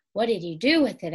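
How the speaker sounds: ordinary delivery, nothing marked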